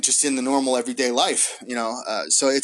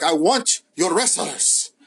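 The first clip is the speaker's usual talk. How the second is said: slow, menacing boss line in a put-on Mexican accent; 2 semitones higher, slower than usual. Podcaster imitating the villain's offer in a Mexican accent. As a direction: deliver it like a shady Mexican promoter, Mexican accent. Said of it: "can I get away with an accent".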